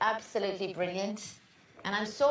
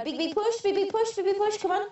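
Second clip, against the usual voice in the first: raised pitch, driving repetitions; urgent coaching; 8 semitones higher than usual. Real, not acted. Midwife to woman in labour, urgent coaching. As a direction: rhythmically urge her on through the push.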